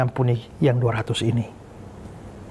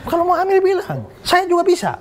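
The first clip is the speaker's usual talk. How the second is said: laughing punchline. Retold or imitated. Joking to the congregation about his old girlfriend, laughing high. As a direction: deliver the joke while laughing it off.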